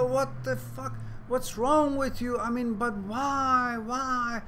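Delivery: high-pitched, aghast frog, drawing out the whys; mock outraged protest. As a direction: act out a betrayed frog's incredulous protest. Stung frog protests to scorpion.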